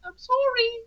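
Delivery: high, insincere sing-song sorry; mock apology. Real, not acted. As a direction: pretend to apologise, not meaning it.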